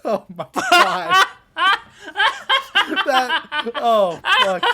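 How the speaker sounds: breaking into loud laughter; can't stop laughing; laughing hard. Real, not acted. Cracking up at a name.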